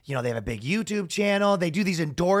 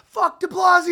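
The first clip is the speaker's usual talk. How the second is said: crowd yell; 13 semitones higher, 4 dB louder than usual. Retold or imitated. Crowd chanting at mayor.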